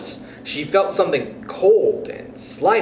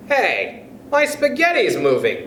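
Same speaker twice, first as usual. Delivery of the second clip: cried out; 7 semitones higher than usual. Acted. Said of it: "cried Mister Twit".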